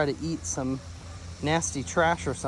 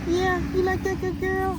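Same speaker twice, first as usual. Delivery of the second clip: much higher, sing-song cooing. Real, not acted. Cooing to dog.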